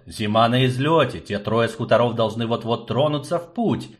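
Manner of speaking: usual speaking voice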